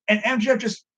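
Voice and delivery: normal talking voice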